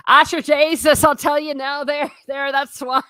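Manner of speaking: high, loud mock Irish accent; hammed-up Irish brogue, pitched high. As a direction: put on a thick Irish accent. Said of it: "I don't know if I did the accent well".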